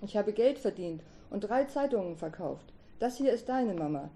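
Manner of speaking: usual speaking voice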